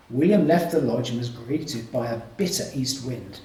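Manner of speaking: ordinary delivery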